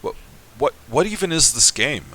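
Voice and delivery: usual speaking voice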